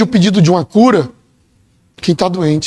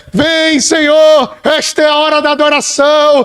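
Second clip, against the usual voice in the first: fervent cry; 6 semitones higher than usual. Pretend. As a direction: lift the call to worship. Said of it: "É lugar de dizer".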